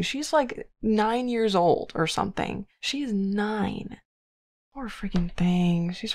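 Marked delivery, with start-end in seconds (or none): 0.0-4.0 "nine" drawn out slowly; emphasizing the age. Real, not acted. Player stressing to viewers how young she is, "nine" drawn out. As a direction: lean slowly and deliberately on "nine".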